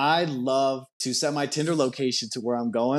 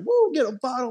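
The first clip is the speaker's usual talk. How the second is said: put-on high squealy girl cheering; imitating the date cheering for the bottle; excited date; 9 semitones higher than usual. Imitating the cute date cheering her on. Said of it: "She's like".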